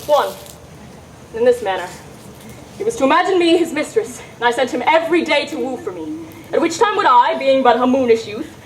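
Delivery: normal talking voice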